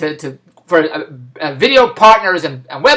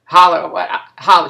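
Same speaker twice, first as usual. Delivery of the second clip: ordinary talk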